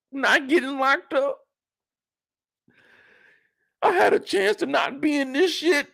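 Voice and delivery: high-pitched, aggrieved rant; upset teen son. Acted. Kanan venting to his mother about his dad, high and upset. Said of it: "You know Kanan is thinking".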